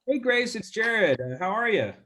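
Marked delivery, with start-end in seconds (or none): none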